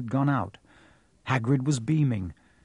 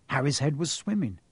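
usual speaking voice

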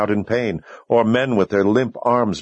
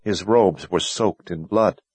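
ordinary delivery

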